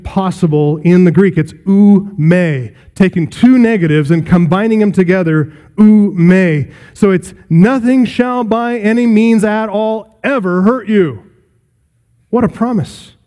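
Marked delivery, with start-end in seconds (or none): 1.7-2.7 "ou me" spoken slowly, each syllable set apart; Greek ou me. Shown as a demonstration. Preacher teaching listeners the Greek "ou me", slow and deliberate. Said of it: "in the Greek language". 7.5-11.2 slow, higher, every word weighted; strengthened emphatic reading. Shown as a demonstration. Preacher giving listeners his stronger translation, slow and weighty. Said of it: "I'd like to strengthen that for you".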